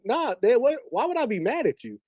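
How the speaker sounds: high, baffled denial; can't believe he's even asking; incredulous. Retold or imitated. Son to dad, denying he's mad, high incredulous tone. Reassuring dad over Popeyes.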